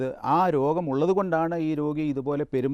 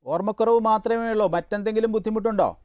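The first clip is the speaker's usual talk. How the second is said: louder, higher repeat for the listener; raised voice; 7 semitones higher, 4 dB louder than usual. Real, not acted. Doctor repeating questions the caller missed, raised voice. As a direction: call the question clearly down the line.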